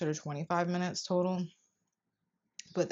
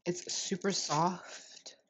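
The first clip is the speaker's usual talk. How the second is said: whispering; much slower than usual. Real, not acted. Shows off the soft texture.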